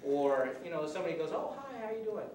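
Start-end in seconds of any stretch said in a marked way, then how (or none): 1.3-2.3 high, sweet friendly greeting; light imitation of a soothing hello. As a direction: greet him kindly to calm things down. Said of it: "That's very calming, very soothing".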